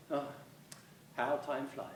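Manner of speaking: normal talking voice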